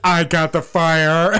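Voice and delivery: loud, drawn-out announcement; mock-heroic shout; hammed-up firefighter. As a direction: call it out slowly, playing the bungling hero. Mocking a bungling firefighter.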